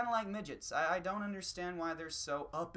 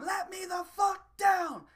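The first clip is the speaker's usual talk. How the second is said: very high, loud, slow furious shout; hammed-up squeaky rage, dangling in the air; angry little man; 9 dB louder, slower than usual. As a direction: scream to be put down. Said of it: "he'd be going".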